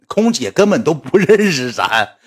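usual speaking voice

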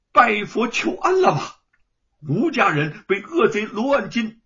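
wife scolding her husband, loud and bitter; angry reproach. Acted. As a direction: fling each question at him in anger. Wife confronting husband in quarrel.

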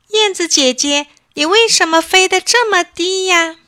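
high, loud call to a bird overhead; calling out loudly. A narrator's reading. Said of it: "就大声喊道".

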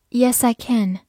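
normal talking voice

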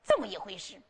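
usual speaking voice

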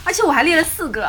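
laughing. Real, not acted. Laughing at own list.

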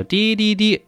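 high, held "dee-dee-dee" honk; imitated bus horn. Distant school bus honking for boarding, imitated horn. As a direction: mimic a horn on one high note. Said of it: "这个巴士已经开始鸣笛了".

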